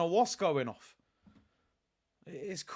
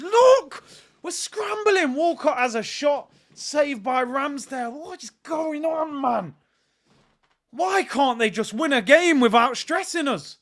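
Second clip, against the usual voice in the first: panicked shouting; 7 semitones higher, 6 dB louder than usual. Real, not acted. Anxious fan during Southampton attack.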